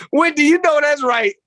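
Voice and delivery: high put-on voice of a woman; a high voice that sounds like someone else; mock wife voice.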